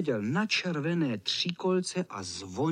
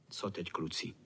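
much softer, low conspiratorial ask; hushed question; 10 semitones lower, 11 dB quieter than usual. A narrator's reading. Freckled boy plotting with his friends, hushed. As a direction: keep it down so no one hears.

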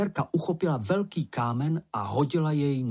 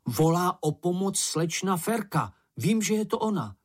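raised, urgent voice; urgent alarm; 6 semitones higher than usual. Acted. Mumínek hearing slečna Ferka call for help, urgent. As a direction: insist urgently, pitch climbing.

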